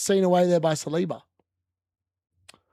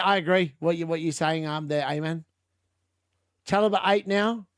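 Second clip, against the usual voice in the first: ordinary talk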